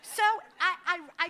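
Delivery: high, loud laughter breaking through the words; can barely talk for laughing; laughing hard. Real, not acted. Speaker laughs telling how her mother-in-law hung up on the fraudster.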